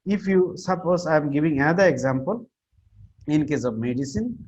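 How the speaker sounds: normal talking voice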